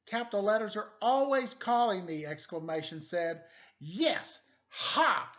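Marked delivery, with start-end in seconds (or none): 0.0-2.2 higher, loud fed-up voice; loud grumble. Acted. Exclamation mark complaining to the detective, fed up. 3.8-5.3 high, very loud, slow imitated yell. Exclamation mark mimicking the capitals, yelling. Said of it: "he yelled".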